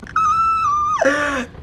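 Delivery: very high, held imitated scream; mock screech. Recapper hamming up the housemate's outburst, mock screech.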